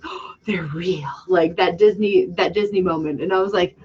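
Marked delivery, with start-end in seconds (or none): 0.0-1.1 hushed, breathless whisper; awed whisper. Retold or imitated. Fan meeting her favorite characters, whispering in awe. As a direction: whisper it like seeing magic. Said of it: "I'm like".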